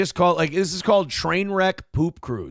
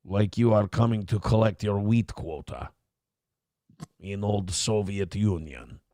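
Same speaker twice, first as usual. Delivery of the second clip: put-on Russian man accent; 6 semitones lower than usual. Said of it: "this is Russian man saying".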